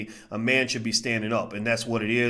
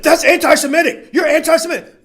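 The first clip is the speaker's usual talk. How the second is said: high, loud, laughing shriek of an accusation; hammed-up outraged cry; mock accuser; 10 dB louder than usual. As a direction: squeal it like a scandalized accuser. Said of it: "they'll be like".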